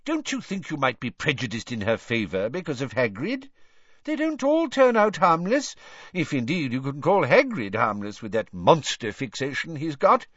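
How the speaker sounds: high, indignant minister; angry. A narrator's reading. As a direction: argue heatedly, pitch leaping up. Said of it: "said Fudge angrily".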